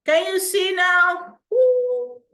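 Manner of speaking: high, loud whoop of delight; excited. Real, not acted. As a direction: cheer as you show the prize. Showing off the awards.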